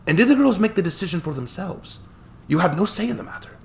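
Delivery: put-on chuckling old attorney, light Scottish touch; cheerful old Scottish lawyer. Hammond presses.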